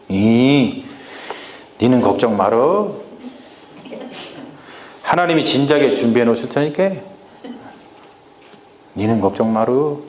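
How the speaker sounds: a hint of a calm Chungcheong country father; Abraham reassuring Isaac in Chungcheong speech; Chungcheong dialect. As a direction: soothe the son in easy Chungcheong dialect. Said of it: "충청도 사투리 한번 해보려고".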